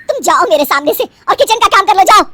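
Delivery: fast, loud, high command; angry order. Acted. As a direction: send her packing in a temper. Mother-in-law sends her away. (गुस्से में ज़ोर से आदेश देना)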